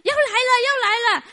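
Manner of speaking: high, rising urgent insistence. Retold or imitated. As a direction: press it like you're compelled to go. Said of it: "后来第三天她说".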